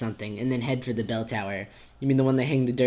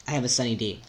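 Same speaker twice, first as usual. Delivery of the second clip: normal talking voice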